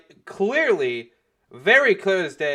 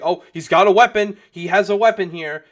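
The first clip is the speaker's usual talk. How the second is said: loud, higher accusing call; mock alarm; 4 semitones higher, 9 dB louder than usual. Protester warning the crowd of a weapon, mock alarm. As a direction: imitate someone raising the alarm.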